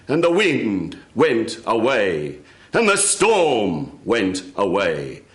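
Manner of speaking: ordinary delivery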